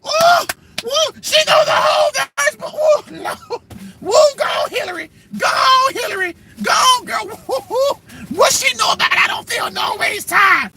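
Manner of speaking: very high, whooping cries; hammed-up gospel hollering; mock church shouting. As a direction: shriek like a fired-up congregation. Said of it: "people in the church just started".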